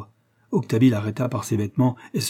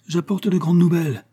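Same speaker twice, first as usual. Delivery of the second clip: higher-pitched, eager announcement; lively; 7 semitones higher, 4 dB louder than usual. Acted. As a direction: announce it with urgency and lift. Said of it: "Le prêtre ajouta vivement".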